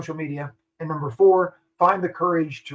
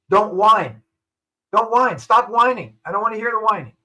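loud, raised-pitch scolding; firm admonition; 5 semitones higher, 5 dB louder than usual. Real, not acted. Guest general orders frustrated listeners to stop whining, firmly. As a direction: tell them off emphatically, voice lifted.

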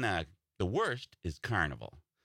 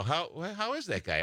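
quoting another comic; 6 semitones higher than usual. Retold or imitated. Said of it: "they go".

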